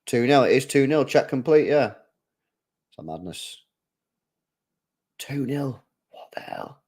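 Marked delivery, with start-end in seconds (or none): 1.9-6.8 stunned whisper; whispered shock at the score. Real, not acted. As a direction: breathe it out in disbelief. Shocked at VAR result.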